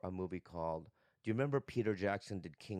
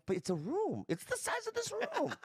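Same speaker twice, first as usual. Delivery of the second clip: incredulous. Retold or imitated. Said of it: "I go".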